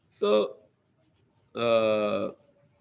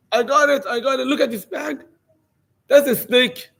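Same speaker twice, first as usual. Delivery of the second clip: high, loud, laughing cry of triumph; excited triumphant yell; 9 semitones higher, 4 dB louder than usual. Retold or imitated. Trapper shouting to the neighbours, excited yell. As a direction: crow over the caught snake with a laugh. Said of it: "starts, you know, screaming".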